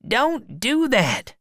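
high-pitched urgent protest; alarmed shout. A narrator's reading. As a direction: cry out to stop him. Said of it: "shouted the other man".

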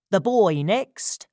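high, loud, slow order; calling down. A narrator's reading. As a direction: call it down from a wall. Said Crackit from the top of the wall.

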